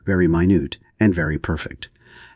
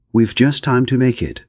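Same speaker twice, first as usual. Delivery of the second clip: higher, urgent call; cried out; 6 semitones higher, 3 dB louder than usual. A narrator's reading. Roger urges Aubrey to run for the train, calling out. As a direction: call out to hurry them both to the train. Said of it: "cried Roger".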